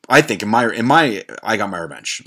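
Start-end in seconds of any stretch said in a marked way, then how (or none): 1.4-2.2 softer, lower murmur of satisfaction; quiet satisfied repeat. Real, not acted. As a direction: savour it quietly to yourself. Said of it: "repeated line".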